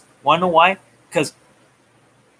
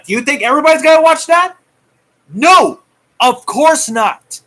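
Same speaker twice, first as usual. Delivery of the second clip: higher and louder, fast ranting questions; heated rant; 6 semitones higher, 5 dB louder than usual. Real, not acted. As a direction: shout down the fans, building to a yell. Ranting at SJW fans.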